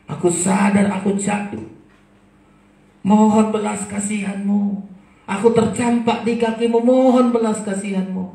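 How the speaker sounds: high, loud begging for mercy; pleading cry. Acted. Sinner crying to God for mercy, loud pleading. Repentant prayer rises to a cry.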